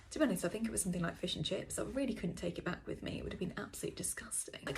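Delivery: ordinary talk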